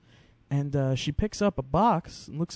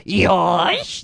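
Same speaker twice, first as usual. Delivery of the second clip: mock fight cry; 6 semitones higher, 7 dB louder than usual. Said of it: "if they come up to you and they go".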